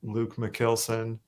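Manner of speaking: McKilson with a short i as in kill; own pronunciation. Shown as a demonstration. Singer shows how he says his own name, [name] like kill. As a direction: slowly say McKilson, KIL like kill. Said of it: "I pronounce my name like".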